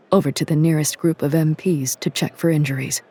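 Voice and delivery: usual speaking voice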